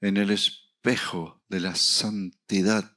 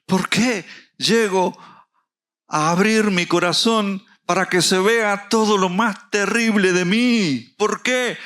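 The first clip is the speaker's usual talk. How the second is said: preaching at full force; 10 semitones higher, 6 dB louder than usual. Acted. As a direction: proclaim it to the whole church. Preaching climax.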